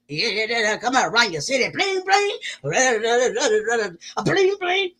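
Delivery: ordinary talk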